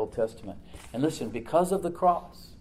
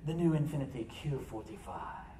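imitating a sophisticated British accent in a car commercial; understated British-accented ad spokesman, softly delivered; Jonathan Price; 5 dB quieter than usual. Preacher recalling an old Infiniti commercial, British accent. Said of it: "He'd say it with that British accent".

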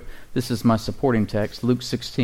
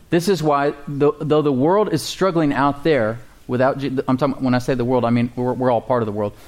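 ordinary talk, nothing marked

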